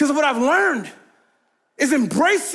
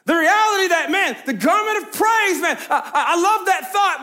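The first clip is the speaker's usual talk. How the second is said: preaching; 3 semitones higher than usual. Acted. Preaching cadence builds.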